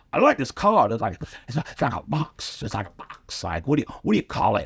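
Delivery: lightly voiced old comic admiring the car; imitating John Witherspoon. Comedian imitates John Witherspoon praising his boxy car. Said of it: "He's like".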